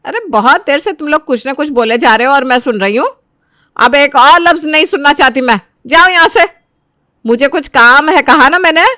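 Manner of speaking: high, loud scolding; angry. Acted. Mother orders her questioning sons out of the room, angry. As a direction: temper rising to a sharp dismissal.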